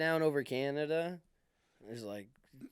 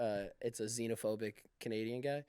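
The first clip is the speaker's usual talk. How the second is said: usual speaking voice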